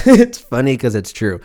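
real laugh through the line. Real, not acted. Repeating the joke to the listener, laughing. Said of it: "It's funny 'cause it's true".

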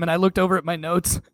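normal talking voice

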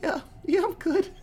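high, choked-up mock voice; mock tearful. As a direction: squeak it out as if holding back tears. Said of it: "he starts to tear up".

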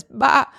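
put-on high sheep bleat for a toddler; playful baa. Mom making the sheep's sound for her toddler, high playful baa. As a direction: bleat like a picture-book sheep.

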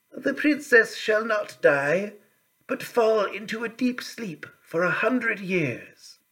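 high, slow fairy voice softening the curse; gentle fairy. A narrator's reading. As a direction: lift the voice high and slow to soothe.

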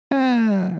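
high, held, mimicked "ah"; mock stalling sound, pitched well up. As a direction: imitate a vacant, drawn-out high "ah". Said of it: "You're like".

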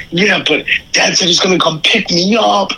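mock high little-brother voice, pleading; whiny kid brother. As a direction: play the younger brother as high and helpless. Imitating younger brother on phone.

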